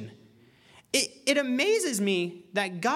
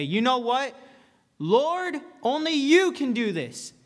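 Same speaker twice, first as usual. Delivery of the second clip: fervent plea; 6 semitones higher, 4 dB louder, slower than usual. Retold or imitated. Preacher voicing a desperate prayer.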